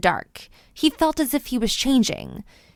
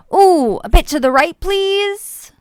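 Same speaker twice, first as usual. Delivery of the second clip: blissful; 7 semitones higher, 4 dB louder than usual. A narrator's reading. Rain like a massage.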